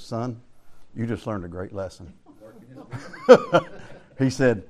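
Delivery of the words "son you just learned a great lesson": low, quiet voice of a dad teaching his son; fatherly lesson. Retold or imitated. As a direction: imitate a father gently driving a lesson home. Dad's hand on boy's shoulder.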